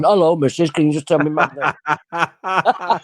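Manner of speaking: lightly voiced chatty dog, breaking into a laugh; talking dog, mock. Medium mocks a dog spirit introducing itself, joking. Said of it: "a dog coming in going".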